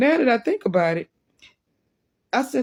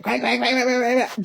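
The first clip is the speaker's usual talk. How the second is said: higher-pitched rapid quacks; duck quacking; 5 semitones higher than usual. Retold or imitated. Duck being carried around the park, steady quacking. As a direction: imitate a duck that won't stop quacking. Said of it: "The duck steady quacking".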